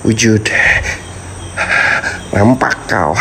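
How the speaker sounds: low, intoned summoning chant; ritual incantation. Acted. Vlogger chanting to summon the spirits, low and solemn.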